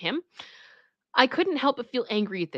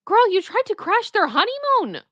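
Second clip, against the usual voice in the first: high, loud incredulous outburst; can't believe it, shouting at her; outraged disbelief; 14 semitones higher, 4 dB louder than usual. Real, not acted. Streamer reacts in outrage.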